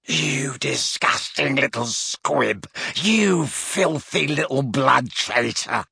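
slow, snarled fury without volume; furious roar. A narrator's reading. Gaunt to his daughter, losing control. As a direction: drag out each insult in rage. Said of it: "roared Gaunt, losing control".

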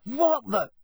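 high, startled outburst; startled gasp. A narrator's reading. Ron sees the thing in the sky, startled gasp. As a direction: blurt it out in shock. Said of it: "gasped Ron".

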